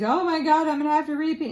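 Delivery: high, loud mock wail; mock panic. Classmate telling the professor she'll fail, in mock panic. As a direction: ham up a classmate's despair.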